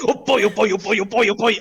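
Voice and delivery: loud, high, bubbling chant; giddy excitement. Acted. Warrior rushing up to the dragon, giddy. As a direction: rattle it out with eager glee. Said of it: "goes down the step and goes".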